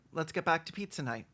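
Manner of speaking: ordinary talk, nothing marked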